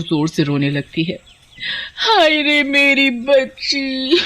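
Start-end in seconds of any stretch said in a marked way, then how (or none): 2.0-4.2 slow, high, put-on wail over the dead girl; fake wailing. Aunt fakes crying at well.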